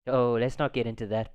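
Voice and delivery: usual speaking voice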